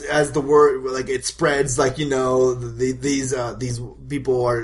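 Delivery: ordinary talk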